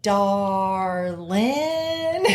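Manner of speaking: slow, high Southern drawl; drawn-out Southern aunt greeting. Retold or imitated. Aunt leaves a message in a Southern drawl. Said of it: "she would be like".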